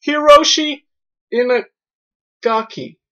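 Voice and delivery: high, drawn-out name announcement; hammed-up big reveal of a name; mock-grand announcer.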